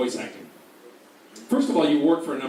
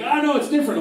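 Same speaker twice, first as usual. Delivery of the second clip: mock director insisting; 11 semitones higher, 5 dB louder than usual.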